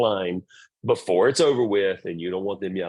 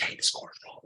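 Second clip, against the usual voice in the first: soft, unvoiced hint to the coach; a discreet whispered tip to the coach; whispered aside; 8 dB quieter than usual. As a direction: lean over and whisper it. Quiet word to the coach.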